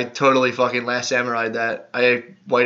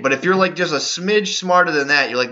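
ordinary delivery

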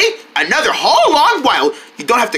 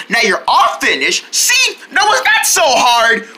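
ordinary talk, nothing marked

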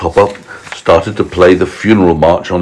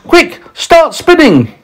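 high-pitched urgent order; yelling; slower than usual. A narrator's reading. James ordering the silkworm to spin, yelling. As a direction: shout it as an emergency command. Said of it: "yelled James".

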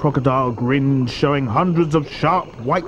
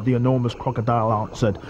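plain reading voice

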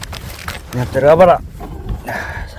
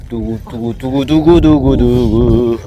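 입으로 내는 드럼롤 소리; drumroll. Pretend. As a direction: build suspense, slowing drumroll. Drumroll before reveal. (긴장감 있는 드럼롤)

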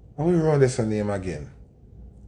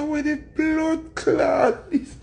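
high, strained mock yell of alarm; retold startled yell; 14 semitones higher, much slower than usual. Startled man yelling at men over the fence, high mock yell.

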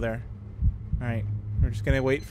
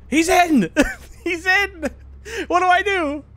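high, frantic panic; panicked; 13 semitones higher than usual. Real, not acted. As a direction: shriek it in a panic. Ghost gets inside.

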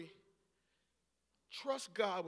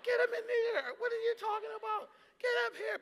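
high, loud mock manager; hammed-up eager host; 13 semitones higher, 8 dB louder than usual. Pastor imitating the manager insisting, high and loud. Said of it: "And it's just".

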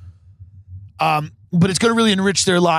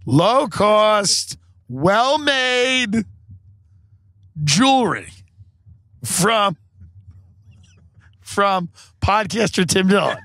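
a bit higher, slow, ad-like pitch; high sales voice; 5 semitones higher, much slower than usual. Shown as a demonstration. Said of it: "no, hold on".

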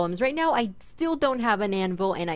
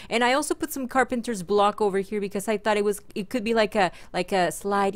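ordinary talk

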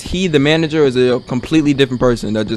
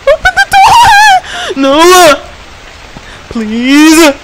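high, loud, drawn-out begging shriek; desperate shrieked plea; panicked scream; 14 semitones higher, 6 dB louder, much slower than usual. Real, not acted. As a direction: scream to be let out. Trapped player panics, begging.

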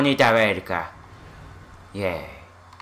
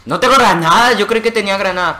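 high, loud cry of dismay; alarmed yell; 6 semitones higher, 5 dB louder, faster than usual. Real, not acted. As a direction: blurt it out loudly, pitched up, speeding up. Panics finding no grenades mid-game.

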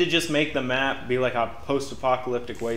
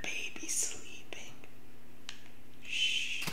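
whispering; 14 dB quieter, much slower than usual. Pretend. Hushing over a sleeping baby.

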